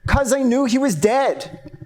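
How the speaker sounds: insistent. Acted. Teenage client to his lawyer who doubts him, insistent. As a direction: insist on it, high and slow. Defendant snaps back at lawyer's question.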